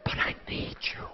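breathy under-the-breath whisper; a private whispered prayer; whispered plea. Retold or imitated. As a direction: whisper it to yourself, desperate. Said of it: "under my breath I'd be saying".

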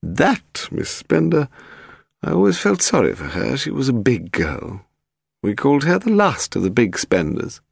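put-on high, malicious old lady; very old woman. A narrator's reading. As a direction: savour the gossip like a spiteful dowager. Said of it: "Her eyes sparkled with malicious life".

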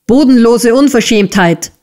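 high, outraged exclamation; raging. A narrator's reading. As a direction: burst out in indignant fury. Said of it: "raste Squeers".

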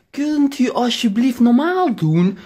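high, loud, slow pleading; exasperated high-pitched plea to the old man; strained plea. Retold or imitated. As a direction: beg him to eat normally, voice tight. Pleading with the eater.

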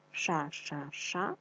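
Ша-ша-ша said slowly, syllables apart; Ша-ша-ша spoken slowly, syllable by syllable; slow syllable drill. Shown as a demonstration. Teacher drills kids on a tongue twister, slow syllables. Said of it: "я шкарпеточки сушу".

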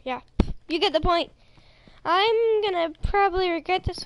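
ordinary talk, nothing marked

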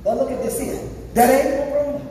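ordinary delivery

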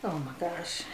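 barely voiced, stunned oh my gosh; awed gasp. Real, not acted. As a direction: let it slip out in awe.

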